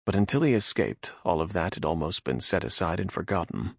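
ordinary delivery, nothing marked

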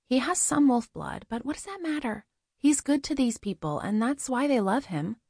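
high-pitched woman's voice, insistent; Goldie defending him. Acted. Goldie argues in Adriel's defence.

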